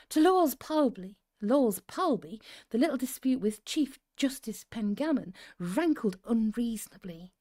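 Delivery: measured, drawn-out read-aloud with strong emphasis; story narration. A narrator's reading. Host reading to cosy listeners, deliberate story narration. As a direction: narrate it slowly, savouring key words.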